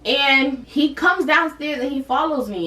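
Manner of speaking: ordinary delivery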